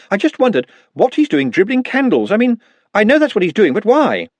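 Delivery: flustered, defending himself; a hint of an anxious academic; Ponder's voice. A narrator's reading.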